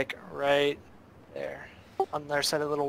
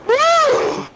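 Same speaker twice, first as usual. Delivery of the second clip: very high, loud whoop; victory whoop; 12 dB louder than usual. Real, not acted. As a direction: whoop with joy. Match won, celebrating.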